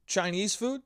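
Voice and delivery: ordinary delivery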